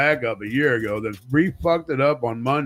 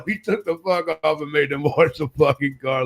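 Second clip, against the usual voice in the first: usual speaking voice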